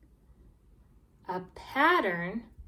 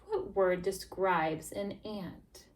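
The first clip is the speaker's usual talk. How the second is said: ordinary delivery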